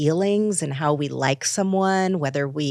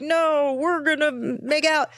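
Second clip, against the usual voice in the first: high-pitched, playful insistence; insistent pheromones; 10 semitones higher than usual. Acted. Pheromones overruling her dating preferences, playfully insistent.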